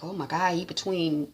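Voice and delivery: quiet worried aside to herself; hushed alarm. Retold or imitated. Viewer reacting to Nick being cornered, hushed alarm. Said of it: "I was like".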